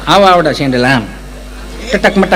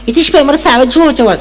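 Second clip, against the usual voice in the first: a higher, mincing educated lady; prim city lady; 6 semitones higher than usual. Retold or imitated. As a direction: mimic a fussy city woman picking each word. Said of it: "Gujarati ચીપી ચીપીને બોલે". (શહેરી બેનની ચીપી ચીપીને બોલતી નકલ)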